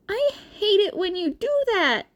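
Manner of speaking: put-on high, anxious teenage boy; awkward nervous laugh then hurry; nervous Izuku voice. A narrator's reading. Izuku covering his nerves with Shinso, high and anxious.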